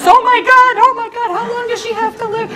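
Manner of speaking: high, loud, frantic oh my God; imitating a sibling in full panic; panicked shriek. Retelling her siblings' frantic replies, high and loud.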